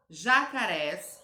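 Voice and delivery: Jacarés slow and loud, stress on RÉS; stress on final syllable. Shown as a demonstration. Teacher shows pupils the strong syllable, Jacarés stressed on RÉS. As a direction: draw out the last syllable of Jacarés. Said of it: "urés".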